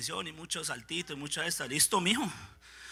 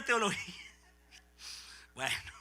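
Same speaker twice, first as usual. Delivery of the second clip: usual speaking voice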